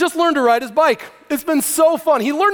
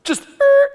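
mock crash noise; 5 dB louder than usual. Crash imitation.

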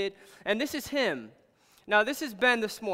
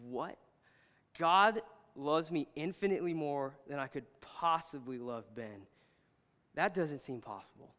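low, hushed wondering to oneself; quiet disbelief; 5 semitones lower, 7 dB quieter than usual. Retold or imitated. Dad holding his baby, pondering God's love in hushed disbelief. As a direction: puzzle over it softly, sinking lower.

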